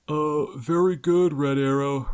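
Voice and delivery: slow, loud, hammed-up goon voice; phony zombie voice. A narrator's reading. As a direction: bluff it in a fake tough voice. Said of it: "Robin vamped".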